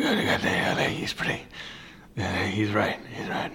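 whispered, defeated admission; ashamed mutter. Retold or imitated. Admitting to his conscience that it is right, whispered. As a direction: concede under your breath, whispering. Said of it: "you're gonna go".

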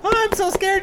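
very high, fake frightened squeal; high mock-scared squeak. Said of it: "she's like".

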